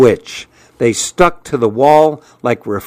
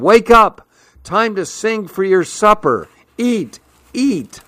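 high-pitched narrated shout, easing in volume; mock shout; 8 semitones higher than usual. A narrator's reading. Boy rousing his lazy lizards to eat, high mock shout. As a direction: call out high, without full volume.

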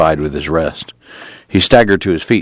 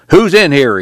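loud, higher call into the tent; 10 semitones higher, 5 dB louder than usual. A narrator's reading. Policeman calling into the next tent, loud. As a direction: bang on the canvas and call out.

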